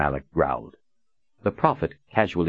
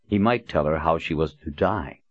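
ordinary delivery

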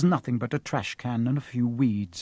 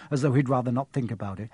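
ordinary delivery, nothing marked

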